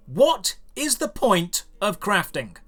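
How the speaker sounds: usual speaking voice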